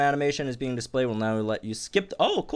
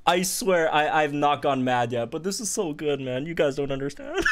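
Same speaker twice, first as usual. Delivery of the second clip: ordinary talk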